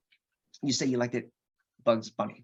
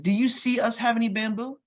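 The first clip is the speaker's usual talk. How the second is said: incredulous question; 10 semitones higher, 4 dB louder than usual. Retold or imitated. Dad questioning son, retold.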